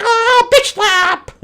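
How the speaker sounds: very high, loud, stretched exclamation; hammed-up cry as the blow lands; comic battle cry. Acting out Mary slapping Queen Bee, comic battle cry.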